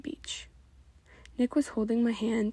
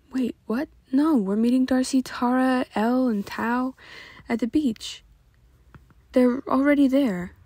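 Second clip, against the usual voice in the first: raised, urgent protest; louder, pushing back on the change of plan; said loudly; 4 semitones higher, 6 dB louder than usual. A narrator's reading. Passenger protesting to Nick, said loudly. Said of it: "I say loudly".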